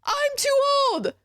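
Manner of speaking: very high whiny mock old man; shrill parody of his complaint; mocking whine. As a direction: squeak out his excuse in scorn. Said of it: "you have the audacity".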